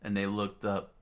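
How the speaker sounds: plain reading voice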